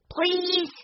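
put-on very high child's plea; pleading echo. A narrator's reading. Young boy echoing his sister's request, pleading. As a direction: echo the begging, high and drawn out. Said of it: "Benny repeated".

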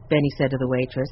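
plain reading voice

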